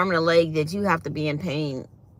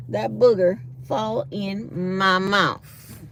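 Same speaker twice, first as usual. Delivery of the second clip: very slow, drawn-out emphasis; emphatic refusal; much slower than usual. Real, not acted. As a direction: spell out the refusal word by word, slowly. Emphatic refusal about the booger.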